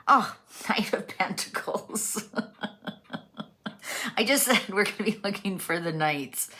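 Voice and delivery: real laughter breaking through the words; laughing. Real, not acted. Reader laughing as the Knight card appears. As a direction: giggle at the coincidence while reading. Laughs at her own prediction.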